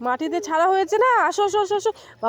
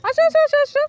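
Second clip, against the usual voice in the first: higher and louder call, coaxing; 9 semitones higher, 5 dB louder than usual. Real, not acted. Mother calls toddler Hiyan over, loud and high.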